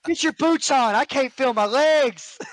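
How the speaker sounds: high-pitched panic; flustered and in pain; panicked. Retold or imitated. Retold drill chaos after fall.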